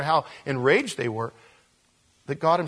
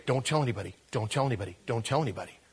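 hushed warning; 4 semitones lower, 6 dB quieter, much faster than usual. Retold or imitated. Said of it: "he would say".

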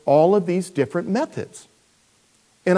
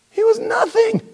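훨씬 높은 음으로 강조하며; high emphatic; 5 dB louder than usual. Real, not acted. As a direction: push it high and ringing. Emphatic peak of argument.

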